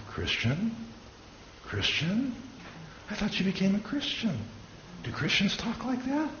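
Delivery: high, soft questioning taunt; softly teasing imitation of doubting teammates; mock incredulous. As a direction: needle him in a high, quiet mimicking voice. Teammates mockingly question his new faith.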